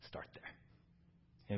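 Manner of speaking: whispered closing charge. Real, not acted. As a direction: drop to a whisper. Quiet closing exhortation.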